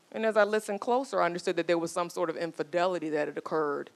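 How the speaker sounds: normal talking voice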